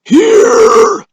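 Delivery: very high, loud called reply; old man's reply. A narrator's reading. Old man answers the roll, very high call. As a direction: sing out from the back. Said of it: "a voice said".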